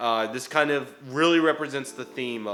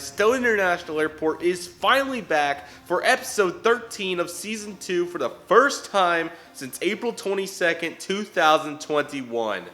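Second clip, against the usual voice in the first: excited; 7 semitones higher, 6 dB louder than usual. Real, not acted. Energetic channel intro greeting.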